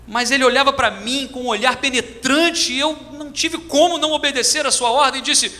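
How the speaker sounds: usual speaking voice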